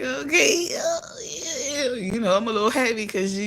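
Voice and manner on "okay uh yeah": high mock shout; sarcastic, high fake agreement; squealed mock okay. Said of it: "So I'm like".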